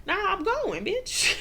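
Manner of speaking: high, loud sassy comeback; mock defiant clapback; defiant sass. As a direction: fire back with attitude. Defiant retort to Karen.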